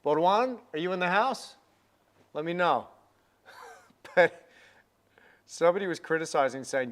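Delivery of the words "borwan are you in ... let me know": Borwan called out higher and louder; calling out. Real, not acted. Artist calls to a French viewer in chat, calling out. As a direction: hail a friend across the room.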